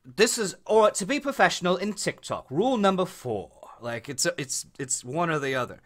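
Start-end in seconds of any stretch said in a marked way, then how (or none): 0.0-3.5 put-on high European accent; European how-to guy. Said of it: "or it's that".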